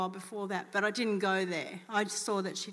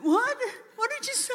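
a stranger caught off guard, much higher; startled disbelief; 14 semitones higher, 5 dB louder than usual. Retold or imitated. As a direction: voice jumps high, sharply startled. Posh lady startled by question.

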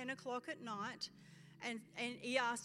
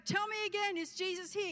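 high, loud rousing call; rousing repeat; 8 semitones higher, 3 dB louder than usual. Retold or imitated. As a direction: push the crowd harder. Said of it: "And he'd go".

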